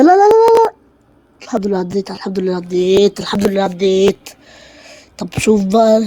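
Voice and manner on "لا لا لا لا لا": high, loud frantic protest; panicked no. Real, not acted. Gamer panicking as his character fails, frantic no. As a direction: cry out in alarm.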